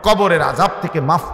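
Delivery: high, forceful sermon with surging peaks; impassioned preaching. Real, not acted. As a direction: preach it hard, rising to loud cries, then dropping back. (আবেগভরা জোরালো ওয়াজের কণ্ঠ)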